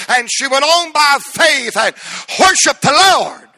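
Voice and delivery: loud, high preacher's cry; full-force preaching. Acted. Preacher telling the congregation about Hannah, full-force cry. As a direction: preach it out to the rafters.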